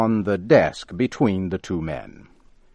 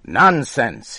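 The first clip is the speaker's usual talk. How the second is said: loud, higher scornful shout; 5 semitones higher, 8 dB louder than usual. Acted. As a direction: bark it back. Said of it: "he shouted".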